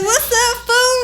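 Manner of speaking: high-pitched mock call-out; startling call-out. Calling to friend cruising by to startle him, high-pitched. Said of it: "I'm gonna try to startle him".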